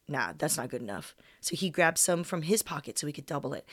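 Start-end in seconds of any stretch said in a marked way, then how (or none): 0.1-1.2 lower, softer voice for the partner; a low, offhand dismissal; partner Corey. Retold or imitated. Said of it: "Corey was like".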